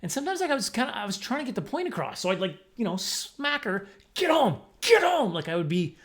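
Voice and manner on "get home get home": high, loud scolding yell; mock angry shout. Child yelling at the dog to go home, mock-angry. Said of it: "showing you that I'm angry".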